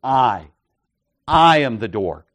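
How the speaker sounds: "I" alone, pause, then "I" hit loud; emphatic I. Shown as a demonstration. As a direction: punch "I" both times, falling away after it. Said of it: "in both of these cases is emphatic".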